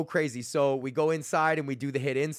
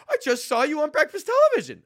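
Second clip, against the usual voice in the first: mock shock; 13 semitones higher, 6 dB louder than usual. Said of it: "she was, like, shocked".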